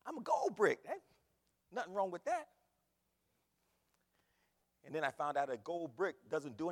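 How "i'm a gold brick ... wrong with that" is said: loud, high, proudly oblivious; imitating a chuffed twelve-year-old; pleased naive kid. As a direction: take the insult as a happy compliment. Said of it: "And I'm thinking".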